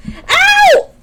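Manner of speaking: high, loud yelp of pain; real sudden cry of pain; sharp pained ow. Real, not acted. Baby grabbed her.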